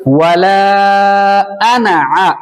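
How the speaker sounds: वला अना आ drawn out, अना kept short; slow corrected recitation. Shown as a demonstration. Teacher correcting the pupil's long आना. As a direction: restart slowly with a short अना. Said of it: "फिर से इसको देखो".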